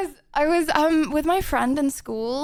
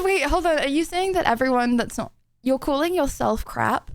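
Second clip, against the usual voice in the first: ordinary talk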